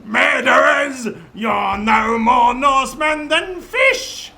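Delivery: high, loud shouted insult; piping battle cry. Acted. Second bodyguard pipes up to insult enemies, high shouting. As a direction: shout it way up high, stretching the words. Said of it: "the other one pipes up".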